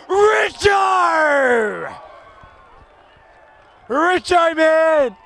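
shouting the player's name; excited shout. Real, not acted. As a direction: cheer him on at full voice. Player marks, crowd cheers.

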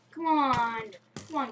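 ordinary delivery

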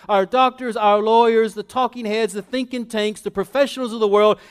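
high, loud, mounting list; full-force preaching. Real, not acted. Preacher urges standing up to professionals, full-force preaching. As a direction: build through every profession without letting up.

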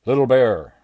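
loud, high bellow at the door; jealous strongman calling out the bear; shouted. A narrator's reading. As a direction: roar it through the trailer door. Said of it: "shouted Bradley".

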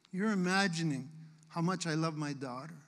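ordinary talk

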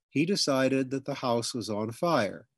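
ordinary delivery

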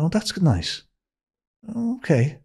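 high, small-voiced politeness; lightly played, lifted and restrained reply; polite acceptance. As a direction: act out a meek, polite thank-you. Politely accepting a compliment, retold.

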